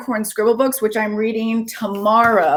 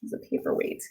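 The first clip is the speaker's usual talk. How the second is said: whispered aside; 14 dB quieter than usual. Real, not acted. Aside to viewers.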